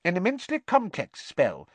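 high, squeaky professor; squeaky little lecturer. A narrator's reading. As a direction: explain in a tiny piping voice. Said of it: "he said squeakily".

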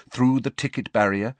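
ordinary delivery, nothing marked

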